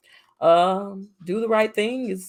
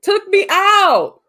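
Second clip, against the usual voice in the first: emphatic echo; 10 semitones higher, 6 dB louder, much slower than usual. Real, not acted. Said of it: "Took you out the game".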